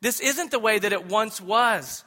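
loud, high mock rebuke as Paul; a hint of an apostle calling them back; Paul rebuking. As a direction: scold the church like Paul. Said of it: "And he's telling them".

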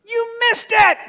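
high, loud shout; preacher scolding the congregation at full volume; shouted rebuke. Real, not acted. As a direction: yell it at the crowd. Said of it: "preacher shouting at congregation".

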